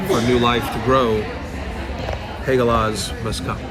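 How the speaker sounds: normal talking voice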